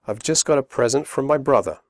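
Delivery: fast connected speech; quick natural read; quickly. Shown as a demonstration. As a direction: run the words together. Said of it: "first slowly and then quickly".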